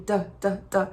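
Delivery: a very soft, reduced "the"; barely touch the unstressed syllable; weak the. Shown as a demonstration. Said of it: "um the bem fraquinho".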